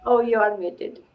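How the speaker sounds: ordinary delivery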